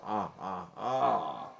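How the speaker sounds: low, deadpan ha-ha-ha; mock a laugh without really laughing; fake laugh. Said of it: "You're supposed to laugh".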